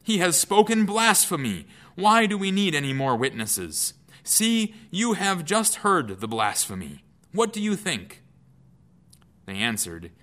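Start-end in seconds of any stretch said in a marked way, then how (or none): 0.0-8.2 high, loud outraged cry; a furious priest stirring the council. Acted. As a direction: cry out in outrage, tearing robes. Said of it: "tore his robes and said".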